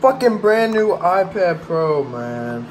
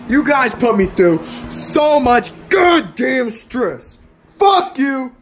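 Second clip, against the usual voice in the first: shouted blame; 7 semitones higher than usual. Real, not acted.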